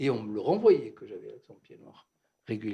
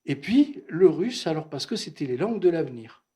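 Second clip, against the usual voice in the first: father's grand plan; 4 semitones higher, 9 dB louder than usual. Retold or imitated. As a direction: hammer home the future like a proud father. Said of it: "Et il me disait".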